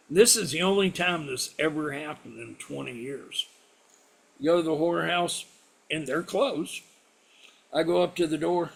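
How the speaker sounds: plain reading voice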